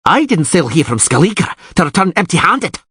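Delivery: angry ranting. Acted. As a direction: rage at the rival suitor. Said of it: "Krach an Kreit yelled".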